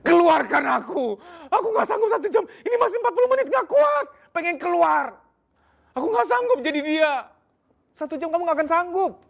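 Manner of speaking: very high, frantic squeal begging to get out; desperate panic. Pretend. Husband trapped in wife's body begging release: frantic high panic. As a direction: shriek like someone trapped and overwhelmed. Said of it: "kamu akan bilang".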